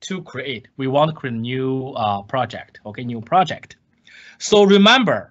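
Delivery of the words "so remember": REMEMBER said higher and louder as a warning; raised emphatic "remember"; emphasis on remember. Shown as a demonstration. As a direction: lean on "remember" to make them pay attention. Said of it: "let me emphasize".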